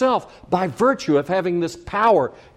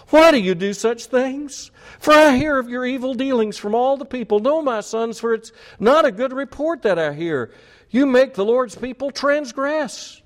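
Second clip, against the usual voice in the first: high-pitched, mildly whiny scolding; whining reproach; 8 semitones higher than usual. Acted. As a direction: plead and complain like an ineffectual father. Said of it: "Was he a whiner?".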